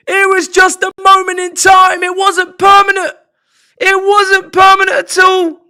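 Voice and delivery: high, loud gleeful shouting; ecstatic shout. Real, not acted. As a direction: roar with delight at the collapse. Rival team concedes late equaliser.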